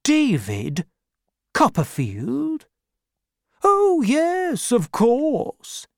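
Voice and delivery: high, unsure then overeager; Mister Dick covering his forgetfulness; vague old man. Acted. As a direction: fake remembering. Dick pretends to remember.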